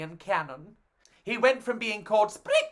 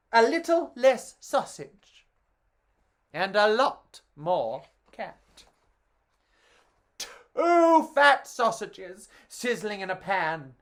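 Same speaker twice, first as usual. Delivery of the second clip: ordinary delivery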